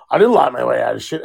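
low, fast mock daughter; mimicking daughter. Dad imitating his daughter, low and fast. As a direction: rattle off a lie as the kid. Daughter voiced in retold argument.

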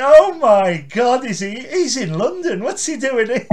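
loud, high amazed outburst; mock astonishment. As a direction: act shocked to spot him.

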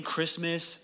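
usual speaking voice

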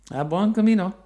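loud cheerful call, falling at end. Retold or imitated. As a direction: shout the farewell warmly. Greeting a passing pilgrim.

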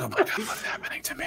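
mock-horrified whisper at your own tears; whispered alarm. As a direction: hush it like you're embarrassed to be tearing up. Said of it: "I'm like".

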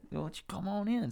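high, soft mock-courteous welcome; imitated polite host. Storyteller voices friend inviting mom in, soft mock courtesy. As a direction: coo the invitation as a meek host. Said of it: "He's like".

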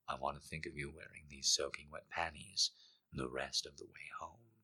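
soft, husky whisper; intimate whisper. Acted. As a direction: breathe it close to her ear. Lover's intimate whisper in story.

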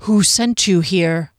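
slow, stern demand; slow, weighty interrogation; menacing guard. A narrator's reading. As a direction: demand it as a ghostly sentinel.

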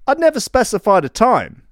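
ordinary talk

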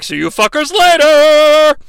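high, loud, slow taunting yell; villain shout. Retold or imitated. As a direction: sneer it as he vanishes. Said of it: "teleports away like".

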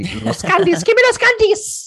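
put-on high, loud candy-grabbing child; greedy kid voice. Greedy kids hunting candy.

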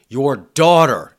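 drawn-out angry emphasis; exclamatory read. Retold or imitated. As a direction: read it like it ends in an exclamation mark. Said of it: "exclamation point".

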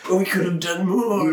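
milk the tragic regret, tongue in cheek; mock solemn hero. Co-host finishing the Captain America joke, mock solemn. Co-host continues the Cap quote.

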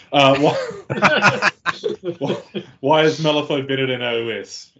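high, loud laugh breaking into the words; laughing incredulously. Real, not acted. Reading out a bait question to the podcast hosts, laughing loudly. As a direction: laugh through the question in disbelief.

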